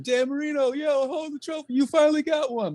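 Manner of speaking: high, hyped fan shout; crowd cheer. Retold or imitated. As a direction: cheer him on.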